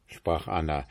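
ordinary delivery

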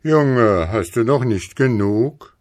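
lightly voiced Moon calling down a reproof; Mond. A narrator's reading. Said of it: "guckte ihm der Mond". (leicht gespielter Mond, lauter mahnend fragend)

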